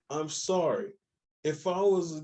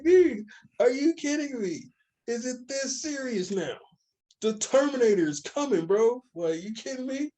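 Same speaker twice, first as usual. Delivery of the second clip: exasperated disbelief; 7 semitones higher than usual. Retold or imitated. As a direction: throw your hands up, are you serious. Exasperated retold rant.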